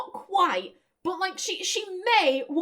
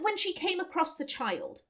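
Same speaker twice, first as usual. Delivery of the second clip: ordinary delivery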